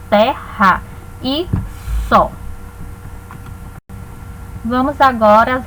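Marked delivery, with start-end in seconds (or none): 0.1-2.3 slow, with pauses between the separated pieces; syllable by syllable. Shown as a demonstration. Teacher showing identical letters in separate syllables, slowly split. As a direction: break it into syllables, one at a time. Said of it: "cada uma em uma sílaba".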